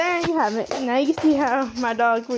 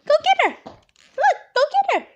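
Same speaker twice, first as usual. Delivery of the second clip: talking to a dog; 13 semitones higher than usual. Real, not acted. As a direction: cheer the dog on to chase. Urging dog to chase bubbles.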